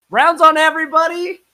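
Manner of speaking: high, loud bar shout; loud yell. Acted. Buying rounds, shouting to the bartender over the room, loud. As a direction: bellow it across the whole bar. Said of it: "scream hella loud".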